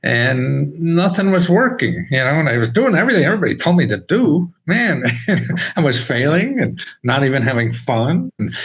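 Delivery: usual speaking voice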